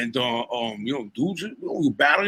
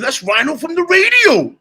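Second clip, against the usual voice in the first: excited reveal; 14 semitones higher, 9 dB louder than usual. Retold or imitated. Punchline, retold friend's correction.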